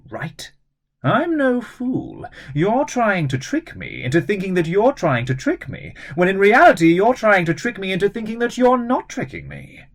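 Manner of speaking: high sly scheming woman's voice; cunning villainess. Acted. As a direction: toy with them, smugly. Miss Gennaro character voice.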